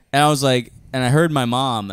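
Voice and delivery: normal talking voice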